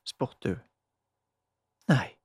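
plain reading voice

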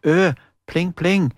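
sung pling pling like plucked strings; plinking guitar imitation; mock guitar plinks. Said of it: "han klimpra vakkert på gitaren".

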